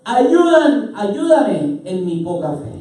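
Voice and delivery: loud, high cry easing into a slow plea; impassioned plea; exclaiming father. Acted. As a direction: cry out for help, then draw it out. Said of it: "exclamó de inmediato el padre".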